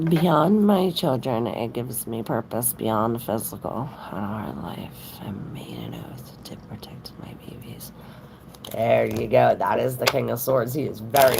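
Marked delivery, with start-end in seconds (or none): 1.5-7.9 unvoiced whispered reading; soft secret whisper. Real, not acted. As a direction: breathe the words out, no voice. Reads a viewer's comment softly.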